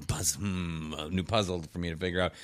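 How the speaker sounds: low, soft puzzled musing; mock Michael musing. Friend retelling the show, mimicking Michael softly musing. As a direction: quietly savour a new puzzle. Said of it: "He's like".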